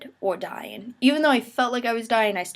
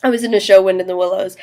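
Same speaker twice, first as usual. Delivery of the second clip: much louder, proclaimed show title; loud announcement; 10 dB louder, faster than usual. Shown as a demonstration. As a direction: announce it like on stage. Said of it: "here it is".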